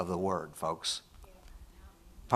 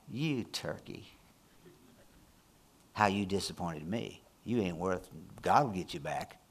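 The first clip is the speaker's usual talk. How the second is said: grudging retort; 2 semitones lower, 6 dB quieter than usual. Retold or imitated. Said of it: "Rather than".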